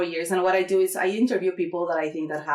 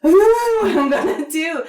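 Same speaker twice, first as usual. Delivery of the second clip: mock panic; 10 semitones higher, 8 dB louder than usual. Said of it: "I was like".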